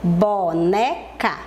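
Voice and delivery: "Boneca" drawn out slowly as bo-ne-ca; stretched teacher's dictation of "Boneca"; syllable by syllable. Shown as a demonstration. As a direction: sound out "Boneca" with a gap after each syllable. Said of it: "Como é que eu escrevo a palavra boneca?".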